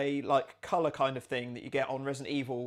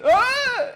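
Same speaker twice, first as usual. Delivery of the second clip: startled yelp; 14 dB louder than usual. Real, not acted. Startled by monsters attacking.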